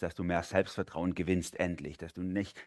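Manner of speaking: ordinary talk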